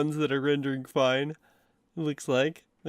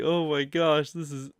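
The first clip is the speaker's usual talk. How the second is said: normal talking voice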